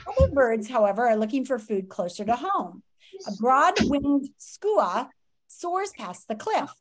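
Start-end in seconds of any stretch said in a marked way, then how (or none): none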